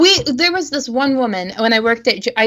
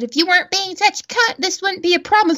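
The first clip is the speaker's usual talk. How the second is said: mock scolding; 8 semitones higher than usual. Retold insult back at customer.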